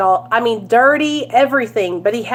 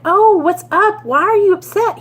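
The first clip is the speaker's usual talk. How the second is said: retold sympathetic check-in; concerned question; 8 semitones higher than usual. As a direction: ask a stranger what's wrong, pitched up. Checking on a sobbing stranger.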